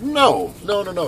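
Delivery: high mock refusal; lightly voiced stingy buddy; refusing friend. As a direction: play the stingy friend saying no. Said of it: "And he says".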